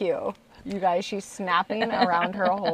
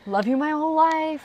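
put-on child voice; 8 semitones higher than usual. Voicing daughter at bedtime.

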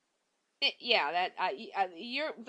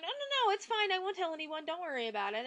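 much higher, sweet reassuring coo; mock comforting; 9 semitones higher than usual. Susan comforting her attacker, high mock-sweet reassurance. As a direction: soothe him like a kind caretaker. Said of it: "she starts telling".

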